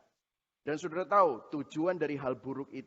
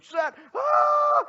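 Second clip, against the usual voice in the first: sudden high yelp of pain; child's cry of pain; 8 dB louder, much slower than usual. Retold or imitated. First needle into toddler's thigh, pained yelp. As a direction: shriek as the needle goes in.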